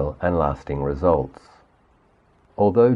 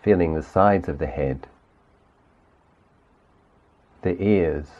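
guided meditation. Acted. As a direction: gently lead the listener through the body, unhurried. Leads guided body sweep meditation.

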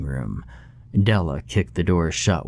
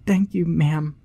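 put-on high, delicate child's thanks; delicate little girl; 10 semitones higher than usual. A narrator's reading. Little girl thanking Della, high delicate child voice. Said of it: "the little girl replied delicately".

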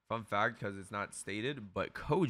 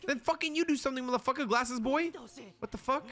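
high, heated yell at the screen; 13 semitones higher, faster than usual. Real, not acted. Streamer yelling at glasses boy on screen, high and heated.

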